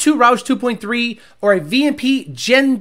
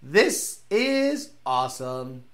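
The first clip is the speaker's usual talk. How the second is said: slow, soft, satisfied drawl; imitating Whipple; 7 semitones lower, 7 dB quieter, much slower than usual. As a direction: savor every word, slowly and quietly. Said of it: "Whipple must be going".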